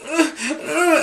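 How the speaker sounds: imitated child's high-pitched whine; short high whimpers, mimicking a scared boy; whimpering. Dad mimics son struggling with the shirt, whimpering. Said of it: "I can hear him whining and whimpering".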